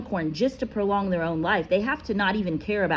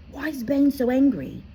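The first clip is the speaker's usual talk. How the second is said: put-on curious boy; 9 semitones higher, 4 dB louder than usual. A narrator's reading. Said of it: "he asked".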